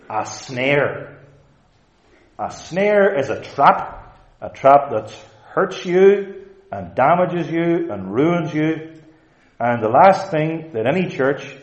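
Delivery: emphatic, high-pitched sermon climax; forceful preaching. Acted. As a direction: drive each listed word home to the congregation.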